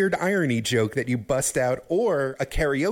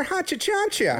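put-on old-timey comic voice. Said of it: "he was like more".

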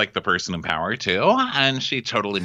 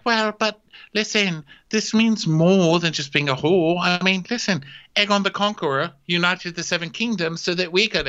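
Ray Ray; 8 semitones higher than usual. Retold or imitated. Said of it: "And so Ray Ray's like".

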